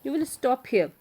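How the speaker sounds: loud and fast, fighting back; angry shout. Acted. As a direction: shout back at his mother. Quarrel with mother.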